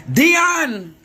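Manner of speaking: loud high call of a name. Real, not acted. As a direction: shout the name across the room. Calling out to her prayer mother.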